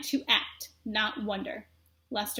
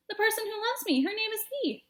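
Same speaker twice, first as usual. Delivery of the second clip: higher, lightly voiced lovestruck; dreamy young mouse; 10 semitones higher than usual. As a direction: say her name with wonder. Despero dreamy about love.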